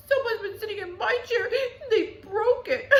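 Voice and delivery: put-on high blubbering baby bear; whiny baby bear. A narrator's reading. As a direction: wail it like a sulky little cub. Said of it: "Baby Bear was quite the whiner".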